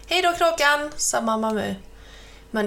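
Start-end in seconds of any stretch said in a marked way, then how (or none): 0.1-0.9 high bright farewell call; calling goodbye. Acted. Mamma Mu calling goodbye to the crow, high and bright. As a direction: wave him off cheerfully.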